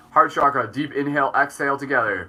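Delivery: calm guide fading to a hush; guided breathing. Acted. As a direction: lead them gently through each breath. Leads a breathing practice.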